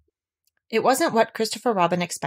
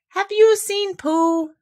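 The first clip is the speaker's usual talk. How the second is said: put-on high, slow worried child; anxious boy's question; 13 semitones higher, 5 dB louder, much slower than usual. A narrator's reading. Christopher Robin cutting Owl off, high and slow. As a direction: pull the words out, fretting.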